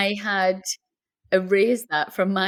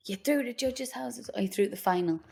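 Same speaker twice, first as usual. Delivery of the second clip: hushed host reveal, much softer; a talent show host holding the suspense; X Factor host; 3 semitones higher, 10 dB quieter than usual. Retold or imitated. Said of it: "mimicking X Factor result reveal".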